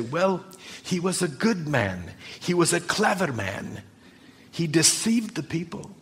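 Helen's words. Retold or imitated. Pastor retelling what neighbour Helen said about Jesus, lightly voiced. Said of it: "she'd say".

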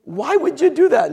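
loud, high-pitched imitation of an outraged objection; incredulous protest. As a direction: cry foul as the baffled prophet. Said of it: "And Habakkuk says".

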